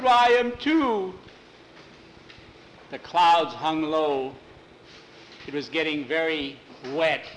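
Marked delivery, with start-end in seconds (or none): 0.0-1.1 scared admission. Acted. Frightened boy in cemetery.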